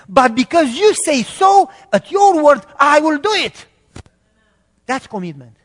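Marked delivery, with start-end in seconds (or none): 0.1-3.7 high, loud, slow declaration of obedience; loud vow. Acted. Peter tells Jesus he will obey his word, loud and high. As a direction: proclaim that you will obey.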